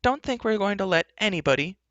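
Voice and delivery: high-pitched defiant heroine; defiant cry. Acted. Heroine protesting to the enchantress, defiant.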